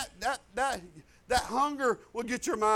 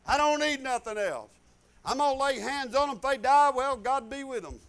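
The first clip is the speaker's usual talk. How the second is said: louder, preaching reply with a shout on satisfied lives; bold declaration; 7 dB louder than usual. Retold or imitated. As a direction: declare it like a preacher in full flow. Pastor retells his bold reply.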